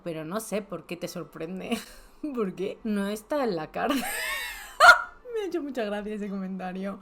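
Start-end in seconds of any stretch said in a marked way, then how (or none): 5.3-7.0 real laughter at a funny remark; laughing. Real, not acted. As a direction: laugh it out and keep laughing through the line. Laughing at the joke.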